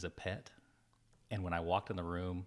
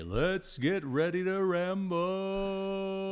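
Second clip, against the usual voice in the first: high, loud, drawn-out announcer call; hammed-up ring announcer cry; 12 semitones higher, 7 dB louder, much slower than usual. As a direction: belt it out and hold the last word like a fight announcer. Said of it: "I still wanna say".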